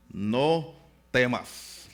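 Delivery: "No temas" stretched out very slowly, each syllable held; slow and solemn. Shown as a demonstration. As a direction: draw out "No temas" like a solemn promise. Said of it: "me encantan esas palabras". ("No temas" muy lento y solemne, alargando cada sílaba)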